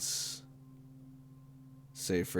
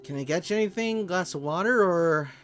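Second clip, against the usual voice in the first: host voice; 10 semitones higher, 3 dB louder than usual. Acted. GM voices a host offering drinks.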